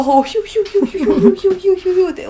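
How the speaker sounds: high fast wheezing whistle; imitated wheezing. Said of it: "なんか嫌".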